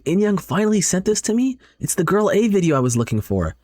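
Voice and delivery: high-pitched delighted surprise; acted excitement. Friend finally receives the story link, acted excitement. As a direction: let the pitch soar with eagerness. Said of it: "She gets so excited".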